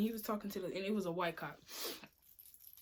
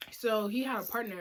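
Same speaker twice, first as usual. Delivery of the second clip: usual speaking voice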